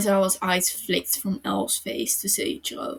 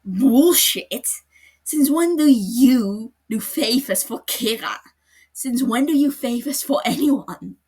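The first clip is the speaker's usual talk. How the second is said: higher, loud furious shouting; angry shout; 5 semitones higher, 12 dB louder than usual. Acted. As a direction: explode at him, shaking with rage. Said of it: "Aizawa shook with anger".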